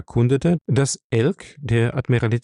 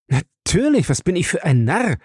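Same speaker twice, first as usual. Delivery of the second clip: sudden realization; 7 semitones higher than usual. A narrator's reading. Dick realizes his mistake.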